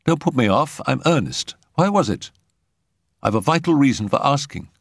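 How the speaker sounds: high, urgent plea from a troubled man; earnest young patient. A narrator's reading.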